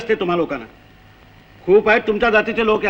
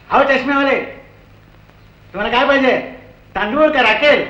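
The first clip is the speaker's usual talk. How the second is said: rude ration clerk; 6 semitones higher than usual. Retold or imitated. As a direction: bark it across the counter like an impatient shopkeeper. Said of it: "समोरून आवाज आला".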